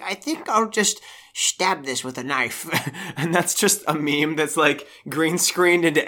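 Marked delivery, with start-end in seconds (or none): 0.0-2.7 soft, slow impression of an elderly monarch; quietly and slowly imitate the Queen; a hint of regal understatement; lightly voiced Queen. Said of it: "She's like".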